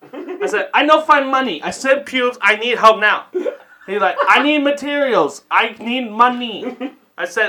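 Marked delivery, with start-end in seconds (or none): 0.7-3.3 higher-pitched, badly faked African accent; poor African accent. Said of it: "In the poorest African accent I can fucking muster". 4.3-6.8 high, insistent mock African accent; poor African accent. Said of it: "In the poorest African accent I can fucking muster".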